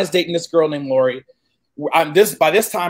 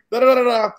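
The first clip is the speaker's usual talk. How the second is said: whimpering nonsense syllables; half-crying babble of fright; mock terrified babble; 4 semitones higher, faster than usual. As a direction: blubber in fear. Babbling in terror.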